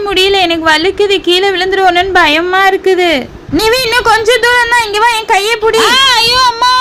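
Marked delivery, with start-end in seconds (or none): 3.6-6.7 panicked cries. Acted. Girl falling from the hill begs friends to save her, panicked. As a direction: scream for rescue as you fall. Girl falls crying for help.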